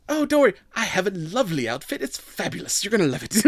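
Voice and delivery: lightly voiced fabulous Valley Girl; breezy, high, gushing Valley Girl; bubbly Valley Girl. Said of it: "she's like".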